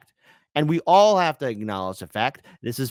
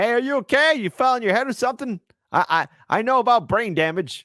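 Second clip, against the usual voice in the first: a lightly voiced, higher mock Biden; 8 semitones higher than usual. Host imitating Biden to the reporter, lightly voiced. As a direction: play him doddering and puzzled, a touch higher. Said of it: "So Biden said".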